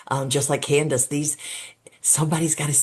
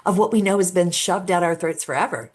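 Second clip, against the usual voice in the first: ordinary talk, nothing marked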